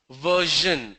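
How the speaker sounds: version drawn out as VUR-zhun; zh example. Shown as a demonstration. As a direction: say version slowly with a buzzed zh. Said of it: "Another example of Z H".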